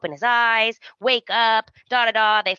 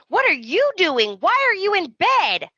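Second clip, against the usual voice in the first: high, indignant confrontation; hammed-up shocked wife demanding answers; mock outrage; 10 semitones higher than usual. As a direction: pitch it up, incredulous. Retold confrontation in bedroom.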